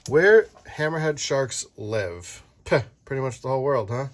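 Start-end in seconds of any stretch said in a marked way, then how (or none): none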